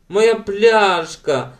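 high, long drawn-out howl; wailing. A narrator's reading. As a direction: wail it slowly like a bereft child. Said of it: "завила, завивала Елен".

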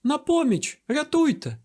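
very high-pitched acted cry for help; urgent squeal, not at full volume; shrieking for help. A narrator's reading. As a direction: cry out like a screaming young woman. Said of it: "заверещала панна Дармії".

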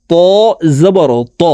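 ত said with a thick, full t; heavy letter. Shown as a demonstration. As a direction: make the t of ত heavy. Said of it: "ত হরফকে মোটাভাবে উচ্চারণ করে পড়তে হবে".

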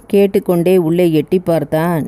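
plain reading voice

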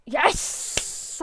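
real burst of relieved triumph; triumphant yell. Real, not acted. Gamer cheering as the parachutes deploy, triumphant yell. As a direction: cheer it out sharply.

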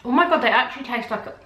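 talking while chewing cracker; mouth full, chewing. Real, not acted. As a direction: hand over full mouth. Seen 1: Cracker eaten at -3; she talks, then covers her full mouth.

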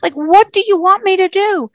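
high, pleading mock scream; imitated outburst at the heavens; retold screaming at God. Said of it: "I start screaming out to God".

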